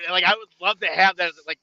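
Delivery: usual speaking voice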